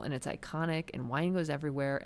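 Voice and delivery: ordinary delivery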